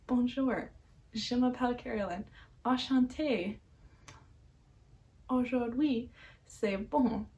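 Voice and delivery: a clumsy attempt at a French accent, lightly performed; a self-conscious show-off line in a French accent; bad French accent. Shown as a demonstration. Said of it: "that accent was actually horrible".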